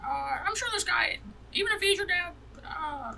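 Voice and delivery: high, concerned character voice; high-pitched NPC voice. Acted. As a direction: play the worried guardian up high. GM plays NPC.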